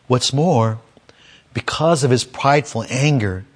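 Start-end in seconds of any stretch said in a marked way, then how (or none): none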